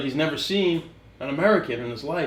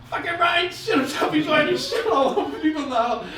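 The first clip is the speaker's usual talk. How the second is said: higher, laughing half-yell; mock shout; 11 semitones higher, 3 dB louder than usual. Quarterback yelling to the coach, laughing shout. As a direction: blurt it out to the coach.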